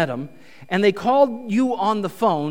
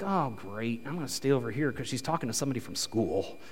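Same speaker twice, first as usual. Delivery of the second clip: low, soft grumble to oneself; resigned muttering; 8 semitones lower, 9 dB quieter than usual. Acted. Husband overhearing wife chatting in Walmart, resigned mutter. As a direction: mutter it under your breath, pitched down.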